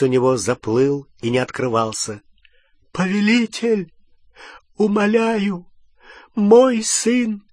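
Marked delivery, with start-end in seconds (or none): 2.9-7.4 high, pleading servant voice; испуганная мольба. A narrator's reading. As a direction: beg your master for your son, pitched high. Малфой умоляет за сына. (испуганная мольба высоким голосом)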